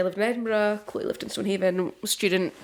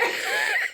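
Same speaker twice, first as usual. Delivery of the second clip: high squeal laugh. Real, not acted. Laughing at herself.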